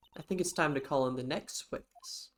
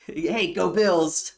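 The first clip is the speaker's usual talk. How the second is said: mock O J; 4 semitones higher, 7 dB louder, much slower than usual. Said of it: "imitating O J again".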